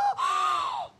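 very high mock gasp; hammed-up scandalised gasp; shocked gasp. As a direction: gasp as if appalled. Retold shock at a confession.

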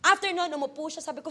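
ordinary talk

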